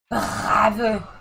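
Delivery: "Bravo" with the R scraped in the throat, French style. Shown as a demonstration. Gamer savouring the co-driver's name, "Bravo" with a French R. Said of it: "how much French people like to pronounce R".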